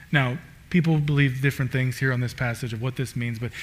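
ordinary talk

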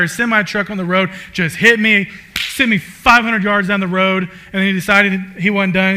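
hammed-up latecomer rushing through an excuse; acted out of breath. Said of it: "I come in out of breath".

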